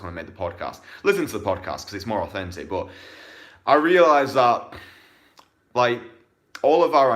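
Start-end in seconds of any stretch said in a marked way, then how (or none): 3.7-7.1 loud emphasis. Real, not acted. Coach building to a punchline.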